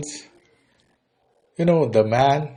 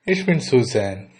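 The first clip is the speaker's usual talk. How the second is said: usual speaking voice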